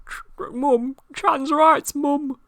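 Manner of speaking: high plaintive mock-accent plea; [name]. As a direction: squeak it earnestly to mum, hammed-up. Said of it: "Listen to him".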